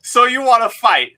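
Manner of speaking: loud, high playful "wanna fight" taunt; teasing tough-guy dare; mock challenge.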